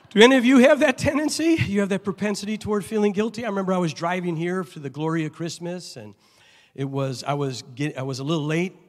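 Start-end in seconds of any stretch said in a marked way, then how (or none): 0.1-3.4 much higher, louder and fast; excited. Real, not acted. Pastor asking the congregation, high and lively. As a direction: fire the question out at the crowd.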